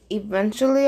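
plain reading voice

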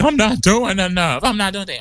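high-pitched self-doubting whine; mock fretting. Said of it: "I'm like".

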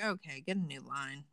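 low, soft, unimpressed brush-off; eye-rolling dismissal. Pretend. Jaded victim to a self-declared devil, unimpressed. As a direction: dismiss it with an audible eye-roll. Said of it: "I'd roll, roll my eyes".

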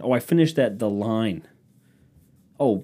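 normal talking voice

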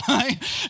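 loud high burst of laughter; high joyful laugh. Real, not acted. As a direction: laugh out the delight. Laugh at peak of story.

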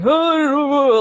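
loud, high wordless wail; imitated shamanic chanting whoop. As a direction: let out a long, high trance-like howl.

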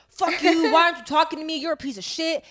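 retold furious yell; angry outburst. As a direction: hurl it at the ex who ghosted her. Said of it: "Then I get angry".

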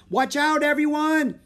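very high, loud warning shout; crying a warning. A narrator's reading. Girl warns crowded bazaar, high loud cry. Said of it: "Annie cried".

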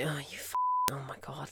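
quiet retold mutter of annoyance; muttered curse. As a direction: grumble it to yourself in disgust. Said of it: "I'm like".

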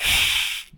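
sharp hiss through bared teeth; cat hiss through teeth. Retold or imitated. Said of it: "like a cat hiss with his teeth".

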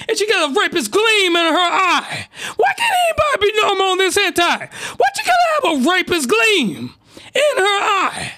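outraged high-pitched screaming; screaming into the mic. Real, not acted. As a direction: shriek the complaint at the mic. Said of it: "every time I scream into the mic".

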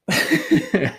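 high burst of giggles; high-pitched, spontaneous laugh; real laughter. Real, not acted. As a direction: laugh helplessly at a funny memory. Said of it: "I'm laughing about my auntie".

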